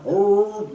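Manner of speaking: high, loud mock hound howl; bay like a coon dog off in the woods. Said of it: "we hear that old coon hound".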